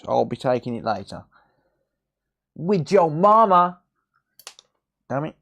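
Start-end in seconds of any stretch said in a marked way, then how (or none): 2.5-3.8 mock taunt. Mocking comeback joke.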